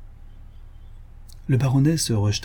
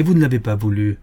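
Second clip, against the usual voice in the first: usual speaking voice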